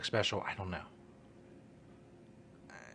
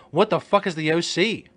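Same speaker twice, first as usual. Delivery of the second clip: mock shout; 6 semitones higher, 8 dB louder than usual. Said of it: "asking Alexa".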